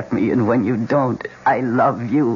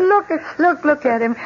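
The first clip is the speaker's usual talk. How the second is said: excited. Acted. Excited over the dog.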